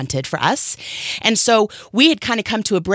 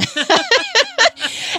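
real high laugh. Real, not acted. Laughs at own joke about husband.

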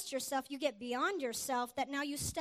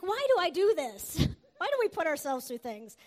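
louder, higher self-mocking complaint with a laugh; exasperated outburst; 3 semitones higher, 8 dB louder than usual. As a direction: groan at your own foolishness mid-race. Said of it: "I'm like".

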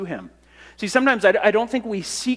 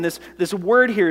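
ordinary talk

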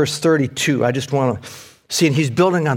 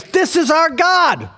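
mock boasting shout; 13 semitones higher, 3 dB louder, slower than usual. Crowd boasting, quoted shout.

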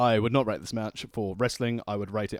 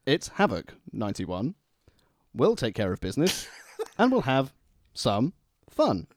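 posh voice; 4 semitones higher, 3 dB louder than usual. Pretend. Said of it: "do it from the beginning in your posh voice".

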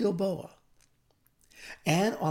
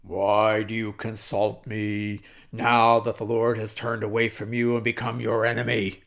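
low ghostly pronouncement, growing louder; Samuel's spirit; 4 semitones lower, 8 dB louder than usual. Acted. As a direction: intone doom like a prophet from the dead. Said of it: "Samuel said".

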